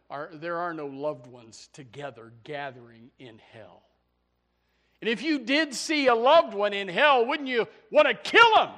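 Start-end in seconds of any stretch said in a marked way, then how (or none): 5.0-8.7 loud, higher, indignant preaching; fiery outburst. Acted. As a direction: fling the question out in a shout. Sudden loud rhetorical question.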